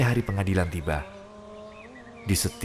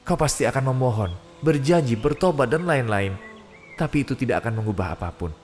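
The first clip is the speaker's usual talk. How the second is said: ordinary talk, nothing marked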